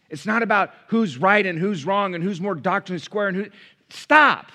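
loud, high preaching push; full-force preaching. Acted. Pastor preaching hard to the congregation, loud. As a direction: drive it home from the pulpit.

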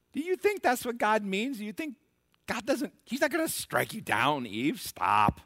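preacher voicing Satan as he tempts Eve; Satan tempting Eve. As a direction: coax Eve like a smooth serpent, then cut off. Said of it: "that's what Satan said to Eve".

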